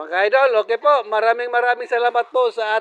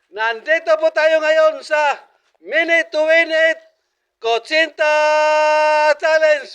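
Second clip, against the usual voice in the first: raised, punchy announcer call; hyped announcement; 5 semitones higher than usual. Real, not acted. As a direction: sell the challenge like a host. Host announcing the eating challenge.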